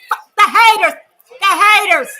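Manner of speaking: loud, high defiant shout; triumphant yell. Real, not acted. Fan taunting the haters after the win, loud defiant shout. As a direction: throw it at the doubters. Celebrating against critics.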